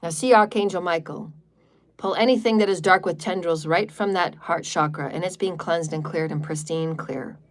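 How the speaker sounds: low, soft meditation voice fading near to a hush; guided visualization. Acted.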